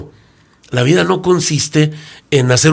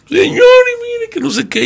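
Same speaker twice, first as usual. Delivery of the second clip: much higher, pleading woman's voice; pleading humble woman; 3 dB louder than usual. Acted. A humble woman pleading her troubles to the businessman, high and plaintive. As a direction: beg like a poor humble woman.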